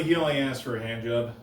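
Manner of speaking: ordinary delivery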